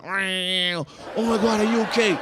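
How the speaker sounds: high, drawn-out cry of concern; mock-alarmed friend calling out. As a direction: stretch the first words, pitched high, like a panicked friend. Friend on scooter reaches the crash.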